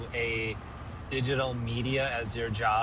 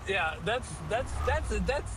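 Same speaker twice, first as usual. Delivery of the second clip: giggly stammer; 8 semitones higher than usual. Real, not acted. Laughing at Louisiana joke.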